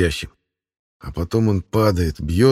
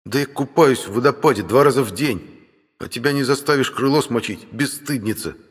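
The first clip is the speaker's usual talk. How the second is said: indignant; 7 semitones higher than usual. Acted. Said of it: "от возмущения".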